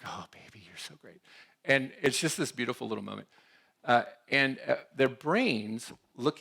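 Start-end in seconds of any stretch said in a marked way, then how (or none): 0.0-1.2 put-on soft, tender cooing at a baby; cooing mom. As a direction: coo to the baby like a doting mom.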